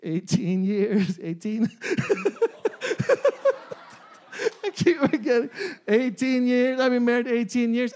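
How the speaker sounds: laughing at his own slip. Real, not acted. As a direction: chuckle out the years you keep forgetting. Laughs at forgetting years married.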